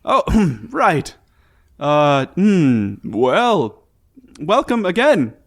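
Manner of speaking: high, loud, stiff character voice; Volo voice. Acted. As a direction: keep the odd high voice.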